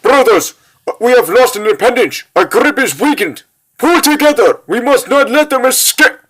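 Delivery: high-pitched, alarmed command; rally your brothers in alarm; urgent cry pitched well up. Acted. Monk rallies brothers as prisoners escape.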